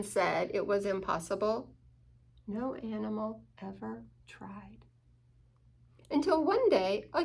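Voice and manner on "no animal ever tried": unvoiced hush; breathed like a secret to children; hushed whisper. A narrator's reading. Hushed storybook line.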